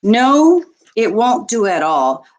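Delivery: normal talking voice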